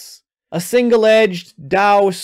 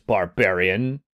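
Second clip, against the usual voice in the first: quiet, lower contemptuous barbarian; low menacing sneer; 10 semitones lower, 6 dB quieter than usual. Acted. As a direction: breathe out barbarian softly with scorn. Captain sneers at prisoner.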